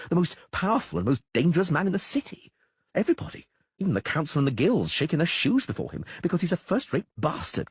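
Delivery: high, fast-talking frightened troubadour; nervous poet Dandelion. A narrator's reading. Frightened bard Dandelion warning Geralt about Chapelle, high and anxious. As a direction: rattle it off high and scared like a jittery bard.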